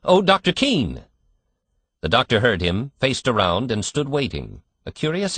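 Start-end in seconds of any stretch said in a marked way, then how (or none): 0.0-1.0 high loud call across the street. A narrator's reading. Father calling to the doctor outside the hospital, loud call. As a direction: call after the doctor from a distance. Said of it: "he called".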